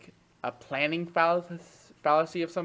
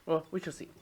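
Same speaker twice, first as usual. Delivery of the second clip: ordinary talk, nothing marked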